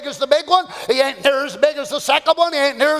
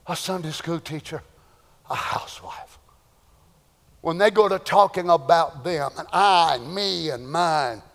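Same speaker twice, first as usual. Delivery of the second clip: hushed sorrow; 9 semitones lower, 5 dB quieter than usual. Real, not acted. Sorrowful list, dropping voice.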